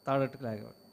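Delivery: ordinary delivery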